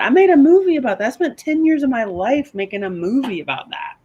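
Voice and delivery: pressing the point hard; emphatic. Real, not acted. Guest insists to host she made a movie, excited. Emphatic self-defense to cohost.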